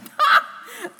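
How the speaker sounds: big ringing laugh; loud burst of laughter. Acted. Laughs at joke about Benno.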